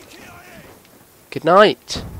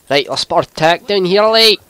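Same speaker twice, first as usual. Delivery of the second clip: a light put-on Scottish accent, a bit higher; 5 semitones higher, 6 dB louder than usual. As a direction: toss the line off in a jokey Scottish accent. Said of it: "The old Scottish accent".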